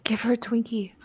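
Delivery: whispering. Real, not acted. Player whispers suggestion.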